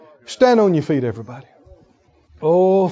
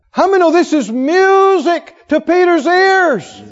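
higher and louder, dragged out slow; shouted preaching; 11 semitones higher, 6 dB louder, slower than usual. Acted. Preacher shouting to congregation that Peter's not out. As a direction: hammer the good news home, slow and loud.

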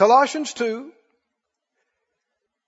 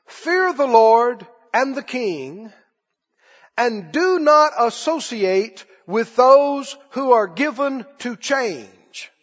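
slow scripture reading; slower than usual. Shown as a demonstration.

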